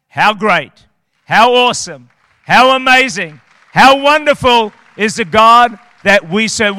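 loud, rising praise call; preaching at full force. Real, not acted.

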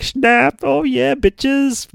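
high, loud mock-bragging bystander; gloating selfie taker. Bystander gloating as he snaps a selfie, high mock brag. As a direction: crow it in a high put-on voice. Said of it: "This dude's just like".

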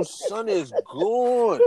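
slow, drawn-out verdict. Retold or imitated. Watching his friend high on dust, slow and amazed. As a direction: stretch it out, amazed.

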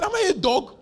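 high, loud indignant yelp; outraged giant. Retold or imitated. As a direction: play the insulted warrior. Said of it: "he was so angry".

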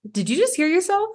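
high incredulous question. Retold or imitated. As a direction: ask it in high disbelief. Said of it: "And I was like".

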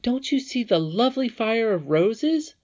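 high, loud, puzzled questioning; bewildered. A narrator's reading. Irene questions Curdie about the roses, high and bewildered. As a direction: ask in bewilderment, voice pitched right up. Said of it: "almost as bewildered as he".

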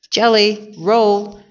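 puzzled storybook exclamation, stretched out; exclaiming. A narrator's reading. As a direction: exclaim both words slowly, in mild disbelief. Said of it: "exclaimed Amelia Bedelia".

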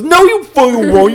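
high, indignant snap; hammed-up betrayed retort; angry retort. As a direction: cut them off in mock fury. Acting out Madara snapping back.

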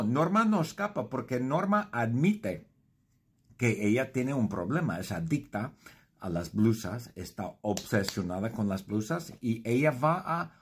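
ordinary delivery

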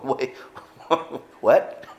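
laughing, startled "what?"; mock disbelief with a laugh; disciples' surprise. Preacher imagining disciples hearing Jesus, amused shock. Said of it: "his disciples going".

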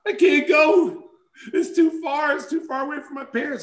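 high-pitched whiny imitation of a crying girlfriend; mock tearful girl voice. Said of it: "She started crying".

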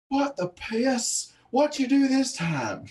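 high, slow, exasperated mom voice; the fed-up mother yelling at her son again; angry mom. Retold or imitated. Kid's mother answering the officer at the door, high slow exasperated. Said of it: "I just heard her go".

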